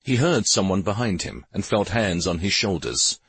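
usual speaking voice

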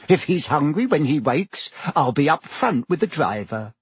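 lightly voiced kindly witch. A narrator's reading. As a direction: voice a cheerful food-cart witch. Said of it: "said the witch".

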